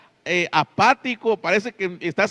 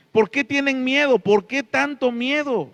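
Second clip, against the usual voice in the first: rebuking cry; 8 semitones higher, 5 dB louder than usual. Acted. Jesus rebuking disciples' fear.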